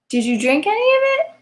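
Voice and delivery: very high, sing-song coaxing question; sweet coaxing mom. Acted. Mother coaxing her daughter to drink, very high and sing-song. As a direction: sweeten it way up like asking a toddler.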